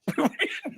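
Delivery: short, high, voiceless laugh. Real, not acted. Golfer laughs at friend's sympathy over his score, short high laugh. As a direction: burst out in a brief breath of laughter.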